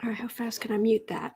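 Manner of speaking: hushed whispered aside; a quick whispered aside; whisper to self. Real, not acted. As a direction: mutter it off-mic. Muttering while adjusting.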